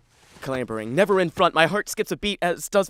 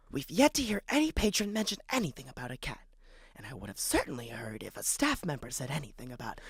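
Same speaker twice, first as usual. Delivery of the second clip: hushed librarian; 5 semitones lower, 8 dB quieter than usual. Acted.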